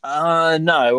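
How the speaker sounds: usual speaking voice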